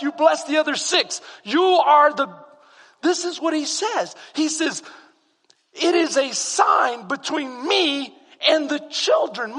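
loud, high, driving sermon delivery; full-force preaching. Acted. Preacher rousing the congregation about God's rest, shouting. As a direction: preach it to the rafters.

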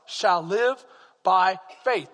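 "live" and "faith" slow and weighted, set apart; separated key words. Shown as a demonstration. Pastor quoting Luther's teaching, "live" and "faith" weighted. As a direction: give "live" and "faith" each its own slow beat. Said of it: "He went just, live, by faith".

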